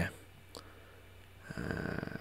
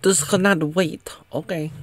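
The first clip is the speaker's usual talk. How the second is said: high, louder mock British accent; 8 semitones higher, 5 dB louder than usual. Other lady stops Reva, urgent in a British accent. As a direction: put on a raised, emphatic British voice. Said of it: "But in a British accent".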